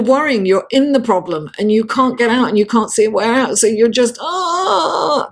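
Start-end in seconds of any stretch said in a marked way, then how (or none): 4.2-5.3 high, drawn-out mock cry of panic; worried wail. Showing viewers what worry is, mock panicked cry. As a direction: let out a high anxious "aah". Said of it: "that's worry".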